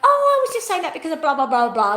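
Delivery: mocking a dismissive person. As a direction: toss it off like it doesn't matter. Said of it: "they'll go".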